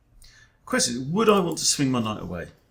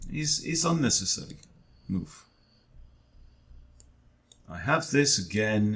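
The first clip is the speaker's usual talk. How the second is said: ordinary delivery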